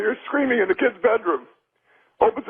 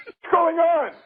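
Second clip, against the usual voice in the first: put-on higher-pitched joke kid's question; puzzled child in a joke; 7 semitones higher, slower than usual. Little kid to his parents in a joke, puzzled. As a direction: ask it high, as the startled kid.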